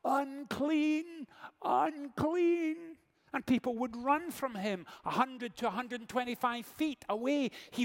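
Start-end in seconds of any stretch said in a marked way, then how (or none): 0.0-3.0 high, loud cry of "Unclean"; a leper crying out in the street; calling out a warning. Retold or imitated. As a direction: call it out to warn people away. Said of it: "he was obliged to call out".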